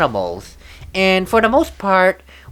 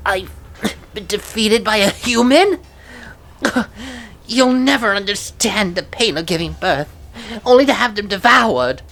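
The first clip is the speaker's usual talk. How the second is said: higher-pitched defeated monster, coughing between phrases; dying, bitter villainess; 5 semitones higher than usual. Acted. Defeated mother lamenting her lost vengeance, dying and bitter. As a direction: gasp out last words through feigned coughs.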